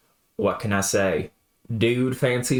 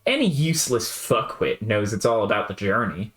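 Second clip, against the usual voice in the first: ordinary delivery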